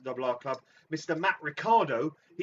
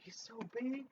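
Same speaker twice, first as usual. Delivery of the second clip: awed hushed whisper; 7 semitones higher, 12 dB quieter than usual. Acted. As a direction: whisper it in awe. Awed whisper about giant.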